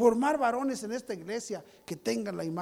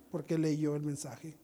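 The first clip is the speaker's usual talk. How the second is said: usual speaking voice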